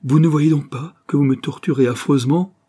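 anguished cry near tears; bursting into sobs. A narrator's reading. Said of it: "s'écria Jacqueline en éclatant en sanglots".

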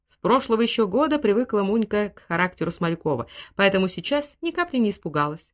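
plain reading voice

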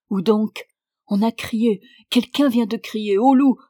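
mildly panicked stammer; frightened stammer. A narrator's reading. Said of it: "balbutiai-je".

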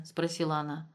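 ordinary delivery

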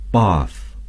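British English bath, with a long open ah; bath said the British way like bahth; British pronunciation of bath. Shown as a demonstration. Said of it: "The British would say".

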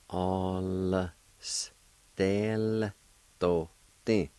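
"All, s, del, do, ti" as isolated sounds; syllable-by-syllable pronunciation. Shown as a demonstration. Teacher showing the L sound with the A, syllable by syllable. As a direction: hold "All", separate the "s", step through "del, do, ti". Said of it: "pronounce the L sound with the A like this".

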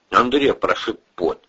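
ordinary delivery

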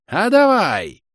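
high, very loud cry; witch's shout. A narrator's reading. Witch answering the giant, high fierce shout. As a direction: shout it right in his face. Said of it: "крикнула ему в лицо ведьма".

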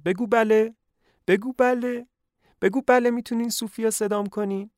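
high, loud pleading coax; eager coaxing. Acted. Lenya urging her daughter to answer, high eager coaxing. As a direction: urge her on like a giddy mother.

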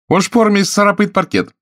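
high, loud hostess cry; shouting. A narrator's reading. Lena orders the guests about, shouting. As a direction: yell it across the room. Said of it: "закричала Лена".

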